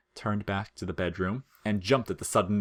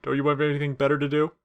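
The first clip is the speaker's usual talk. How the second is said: lazy teasing skeleton, then higher; laid-back comic skeleton voice; Sans voice; 6 semitones higher, 5 dB louder, faster than usual. Acted. Sans teases Frisk.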